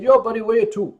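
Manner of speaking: imitating a Newfoundland taxi dispatcher's accent; "where you to" in a thick Newfoundland accent. Taxi dispatcher answering a confused caller, Newfoundland accent. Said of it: "The Newfoundland accent is a bizarre combination".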